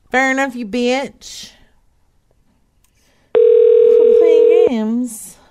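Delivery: slow, loud, high drawn-out scold; high, drawn-out frustrated yell; annoyed yell. Real, not acted. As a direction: drag out the complaint at the machine. Yelling at phone menu.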